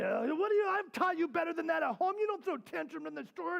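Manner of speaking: high, worked-up parent telling off; mock scolding. Old me to daughter in the store, mock scolding. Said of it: "I would say".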